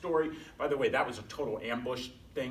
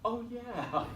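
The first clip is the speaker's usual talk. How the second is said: laughing recall; 11 semitones higher than usual. Retold or imitated. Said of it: "I was like".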